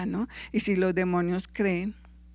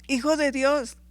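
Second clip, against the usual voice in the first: raised, higher-pitched cry, lightly acted; demons crying out; 6 semitones higher, 3 dB louder than usual. A narrator's reading. Preacher voicing demons crying out to Jesus, raised high. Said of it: "los demonios gritaron".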